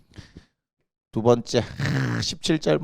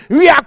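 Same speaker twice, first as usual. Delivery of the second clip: mock yell; 10 semitones higher, 7 dB louder than usual. Teacher's yell in class.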